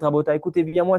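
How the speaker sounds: usual speaking voice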